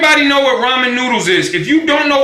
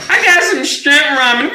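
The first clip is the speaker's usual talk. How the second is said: higher, lightly mocking quote; mock ramen buyer; 6 semitones higher than usual. Someone showing off their shrimp ramen, mocking imitation. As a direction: mimic a boasting buyer, pitched up.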